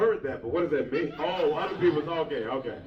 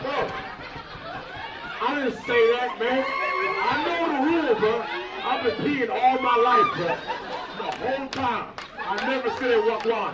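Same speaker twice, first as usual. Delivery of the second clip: indignant shout; 6 semitones higher, 5 dB louder than usual. Real, not acted.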